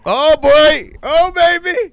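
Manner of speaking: excited anticipation. Real, not acted. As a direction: whoop with glee at the coming story. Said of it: "reacting to story".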